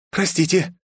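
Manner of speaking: high call across the lot. A narrator's reading. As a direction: shout over the engine. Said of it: "прокричала Аюми". (выкрик)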